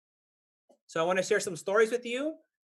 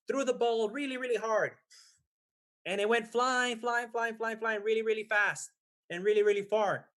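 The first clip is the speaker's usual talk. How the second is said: higher, lively storyteller voice; storytelling to children; 4 semitones higher than usual. A narrator's reading. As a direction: make the ball's flight exciting for kids.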